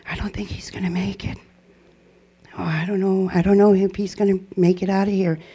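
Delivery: whispered nurse gossip, barely voiced; faint, breathy worried whisper fading to a murmur; hushed whisper. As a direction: hush it like gossip kept from the family. Nurses doubting his survival.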